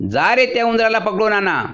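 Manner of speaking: king commanding. A narrator's reading. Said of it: "शिपायांना म्हणाला".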